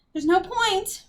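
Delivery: high, lightly voiced whimper; mewed. A narrator's reading. Millicent gives up on helping the boys, mewing. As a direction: mew it helplessly. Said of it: "Millicent mewed".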